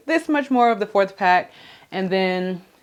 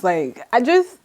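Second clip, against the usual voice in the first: excited; 5 semitones higher than usual. Real, not acted. Gushing about the hair.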